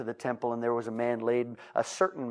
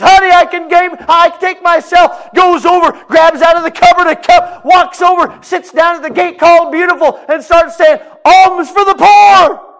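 healed man returning to beg; healed man begging again; 23 dB louder than usual. Acted. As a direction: wail for alms again.

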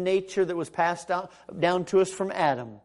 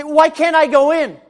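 acted protest; 11 semitones higher, 14 dB louder than usual. Acts out protest to surgeon.

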